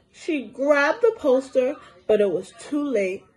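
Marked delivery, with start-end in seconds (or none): none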